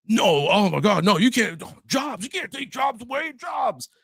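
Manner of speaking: shrieky, very high hammed-up outcry; mock a crowd panicking about losing jobs; mock panicked protest. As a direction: climb into a frantic falsetto wail. Acting out outraged objectors to job loss.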